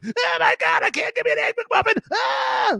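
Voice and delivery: very high, hammed-up whining cry; mock wail. Streamer mocks himself missing McDonald's breakfast, fake wail. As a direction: wail about the missed breakfast, squeaky and fast. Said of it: "my reaction".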